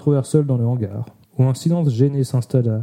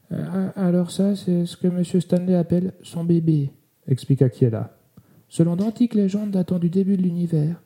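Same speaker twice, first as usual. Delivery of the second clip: timid slow voice; 5 semitones higher than usual. Acted. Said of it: "de la même voix lente et inaudible".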